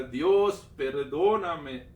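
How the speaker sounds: loud, drawn-out plea; retold crying out. Man retells begging God for pardon, crying out. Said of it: "yo le seguía clamando".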